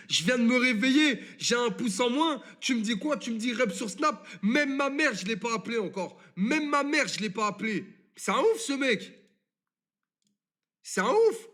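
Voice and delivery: high, indignant ranting, voice climbing; exasperated disbelief, pitched well up; outraged rant. Real, not acted. Venting to the stream about a friend, high indignant rant.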